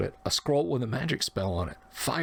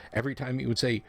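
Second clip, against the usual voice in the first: ordinary delivery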